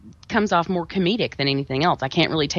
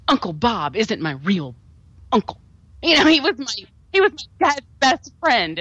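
imitating an overwrought actress; mock dramatic reveal; 5 semitones higher than usual. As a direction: ham up a horror heroine's big reveal. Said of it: "that really dramatic reveal from Danielle Harris where she's like".

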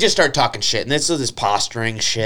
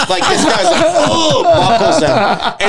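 laughing; 4 dB louder, slower than usual. Real, not acted. Laughing while retelling a punch.

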